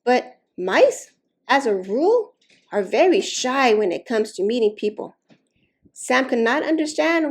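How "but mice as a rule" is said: ordinary delivery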